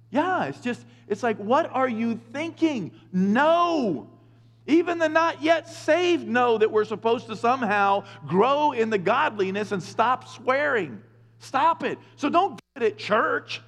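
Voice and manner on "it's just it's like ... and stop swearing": ordinary talk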